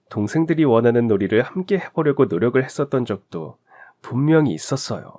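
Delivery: ordinary delivery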